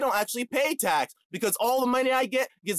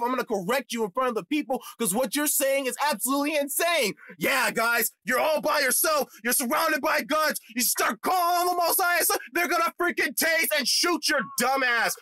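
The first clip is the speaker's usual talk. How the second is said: raised, heated yell at a caller who won't listen; angry shouting rant; 7 semitones higher than usual. Retold or imitated. Telling viewers how he overtalked the caller, heated shouting. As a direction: shout him down, fired up and higher.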